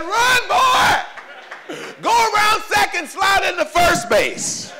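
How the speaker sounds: high shouted cheer from the bleachers; yelling from the stands. Retold or imitated. Father in the stands yelling to his son running bases, loud and high. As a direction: holler it at your kid across the field.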